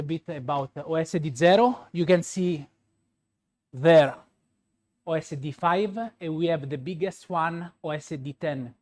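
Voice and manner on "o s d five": ordinary talk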